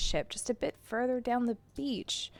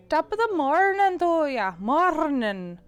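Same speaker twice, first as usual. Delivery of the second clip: Irish accent; 5 semitones higher, 4 dB louder, slower than usual. Shown as a demonstration. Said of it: "talking out of the side of your mouth".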